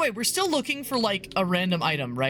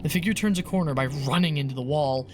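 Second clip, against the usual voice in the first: low, even read of grisly horror text; story narration; 9 semitones lower than usual. Acted. Streamer reading grisly game text aloud to viewers, story narration. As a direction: narrate the horror steadily and descriptively.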